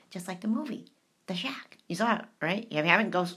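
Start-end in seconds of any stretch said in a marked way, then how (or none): 0.1-1.8 whisper. Real, not acted. Whispered aside.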